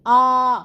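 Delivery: आ held open, loud and long, nothing blocking the breath; open vowel demo. Shown as a demonstration. Teacher shows students a loud open आ. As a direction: sing out a clear open आ. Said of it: "कोई रुकावट नहीं है".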